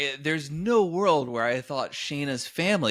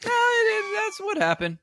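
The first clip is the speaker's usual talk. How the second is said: high insistent retort; 4 dB louder than usual. Retold or imitated. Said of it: "And I said".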